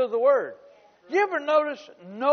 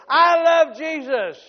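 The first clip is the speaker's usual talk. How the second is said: a slow, louder call of I love Jesus; shouted praise; 7 semitones higher, 9 dB louder, slower than usual. Retold or imitated. Congregation shouting praise before the service, slow and loud. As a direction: call it out to the room. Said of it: "we used to shout before the service".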